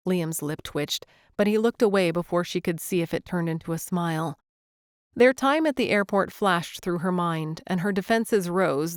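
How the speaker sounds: ordinary delivery, nothing marked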